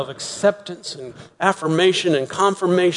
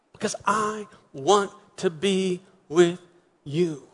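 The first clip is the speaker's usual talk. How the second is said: tender invitation; 5 semitones higher than usual. Acted. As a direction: gently beg them to come out. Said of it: "And Jesus says".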